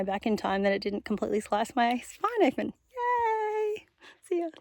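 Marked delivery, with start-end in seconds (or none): 2.9-4.5 excited cheer. Real, not acted. Cheering after near injury.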